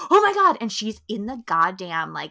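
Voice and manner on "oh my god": high, loud panicked cry; loud high panic in a stuck lift; panicked gasp. Retold or imitated. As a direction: kids panicking, pitched way up. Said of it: "they're like".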